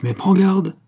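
high, loud threatening caution; forceful threat to an underling; stern warning. A narrator's reading. Captor threatens the terrified killer.

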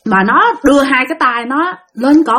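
ordinary delivery

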